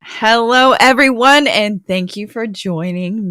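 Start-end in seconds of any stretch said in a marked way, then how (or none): none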